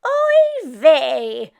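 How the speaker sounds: Meshka's complaint; complaining oy vey. Acted. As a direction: start to moan. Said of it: "start to complain and say".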